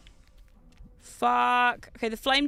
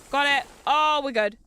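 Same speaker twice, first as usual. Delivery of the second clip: ordinary delivery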